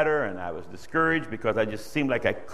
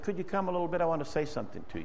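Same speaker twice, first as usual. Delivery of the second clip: timid request; 3 semitones higher, 11 dB quieter, much faster than usual. Retold or imitated. Timidly asking brother to come.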